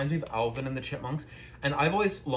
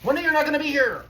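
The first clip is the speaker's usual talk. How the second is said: whiny pleading; 9 semitones higher than usual. Retold or imitated. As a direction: beg like a whining kid.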